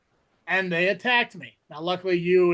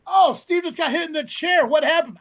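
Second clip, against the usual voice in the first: higher, louder dumb question; mock clueless fan; 7 semitones higher, 4 dB louder than usual. Host mocking fans, higher and louder. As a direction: play the baffled fan.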